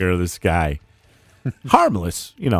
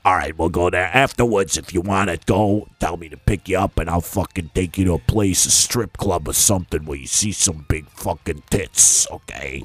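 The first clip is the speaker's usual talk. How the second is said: low put-on Brooklyn accent, swaggering; Brooklyn driver voice; 5 semitones lower than usual. Friend imitating Vegas Phil greeting them, in a low Brooklyn accent. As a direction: play the pushy New York limo driver. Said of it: "he talks like this".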